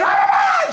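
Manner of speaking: very high, loud repeated squawks; mock angry shrieking. French teacher snapping at a confused pupil, mock shrieking. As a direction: imitate an exasperated teacher shrieking. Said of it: "She's like".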